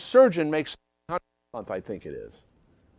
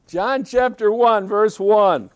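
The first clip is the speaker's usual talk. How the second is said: louder, slower, each word weighted; emphatic announcement; 4 semitones higher, 7 dB louder, slower than usual. Acted. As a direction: call out the reference to the whole room. Preacher repeats the reference, emphatically.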